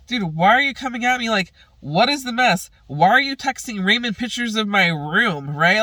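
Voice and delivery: annoyed. Retold or imitated. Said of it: "so annoyed by that".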